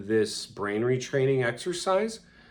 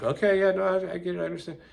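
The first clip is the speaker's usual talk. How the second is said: forced brave reply; 9 semitones higher, 3 dB louder, faster than usual. Retold or imitated. Forced acceptance of coach leaving.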